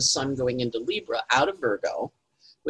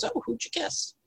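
high, soft, eager priest voice; pry like a curious priest; nosy priest; 13 semitones higher, 8 dB quieter than usual. Acted. Priest in the joke quizzing the altar boy, high and soft. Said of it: "And the priest goes".

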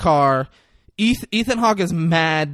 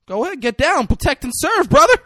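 high, loud taunting cheer; hammed-up rousing taunt; mock egging-on; 13 semitones higher, 7 dB louder than usual. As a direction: goad them on, pitched way up. Denzel egging on the cops, retold.

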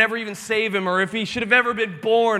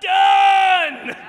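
booming God; 10 semitones higher, much slower than usual. Retold or imitated. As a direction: thunder it out slowly. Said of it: "God's like".

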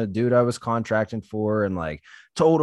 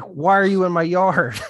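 pissed neighbor; 5 semitones higher, 5 dB louder than usual. Retold or imitated. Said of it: "this dude was pissed".

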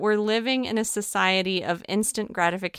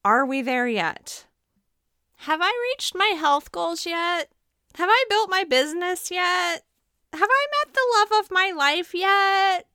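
put-on high impatient child voice, growing louder; pestering child; 11 semitones higher, 3 dB louder than usual. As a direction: whine it like a kid on a long drive.

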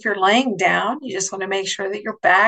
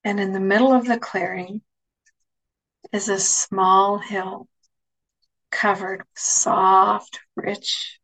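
guided meditation. Acted. Leads a guided imagery meditation.

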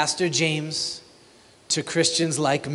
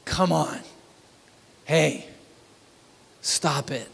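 stop-it rebuke. Shown as a demonstration. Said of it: "That's the spirit of these first words".

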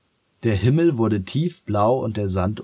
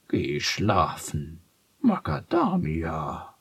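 slow donkey voice; 2 semitones lower, slower than usual. Acted. Donkey sends cat to bed.

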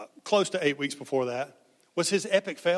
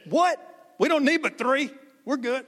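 high-pitched, incredulous reaction; surprised objection; 8 semitones higher than usual. Acted. Judge hearing of five hundred witnesses, surprised objection. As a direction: react amazed at the overkill.

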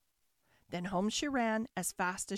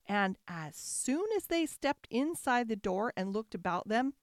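ordinary delivery, nothing marked

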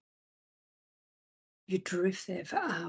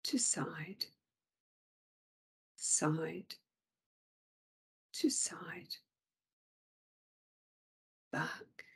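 breathy lulling whisper; soft whisper; 4 dB quieter, slower than usual. Acted. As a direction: whisper it like rocking a child. Lulling whisper inside the meditation.

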